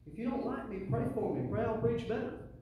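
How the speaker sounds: ordinary talk, nothing marked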